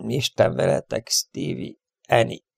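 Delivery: soft, nearly voiceless farewell; sighed to himself. A narrator's reading. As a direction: sigh a goodbye under your breath. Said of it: "sóhajtotta magába". (halk, sóhajtott búcsú)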